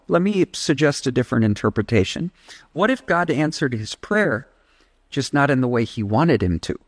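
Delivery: usual speaking voice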